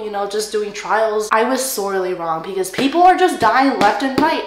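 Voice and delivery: ordinary talk, nothing marked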